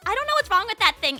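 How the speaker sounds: normal talking voice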